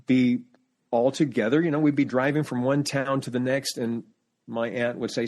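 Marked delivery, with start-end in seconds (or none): none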